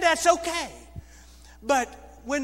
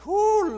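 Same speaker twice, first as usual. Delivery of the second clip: high, loud panicked whoop; panicked whoo; 11 semitones higher, 8 dB louder than usual. Real, not acted. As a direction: let the panic burst out. Panic at the news.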